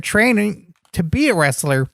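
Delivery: ordinary talk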